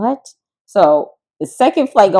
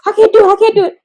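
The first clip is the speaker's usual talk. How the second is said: high, loud, near-tears refusal; retold panic, close to tears; panicked; 7 dB louder, faster than usual. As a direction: beg off in panic. Retold panic, then started crying.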